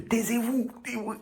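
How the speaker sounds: high squeaky imitation telling them to shut up; mock shushing. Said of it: "Je leur fais". (imitation aiguë de « taisez-vous »)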